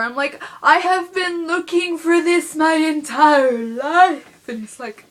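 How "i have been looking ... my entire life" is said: slow, loud, higher, hammed-up gush; dramatic gushing. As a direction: overplay the longing like a lifelong dream fulfilled. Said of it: "I don't actually act like that".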